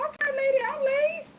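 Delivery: high, light okay-I'm-going; calm backing off. Retold or imitated. Backing off from screaming woman.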